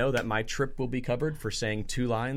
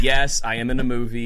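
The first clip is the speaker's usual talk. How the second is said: normal talking voice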